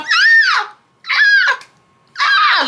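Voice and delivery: loud, very high-pitched crying screams; exaggerated bursts of bawling, acted out; mock crying wail. Daughter getting whooped by mom, hammed-up crying wails. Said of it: "I'm just crying".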